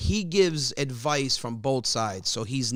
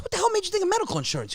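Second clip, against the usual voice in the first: much higher, fast exasperation; rapid disbelief; much faster than usual. Retold or imitated. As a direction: rattle it off baffled. Baffled at father.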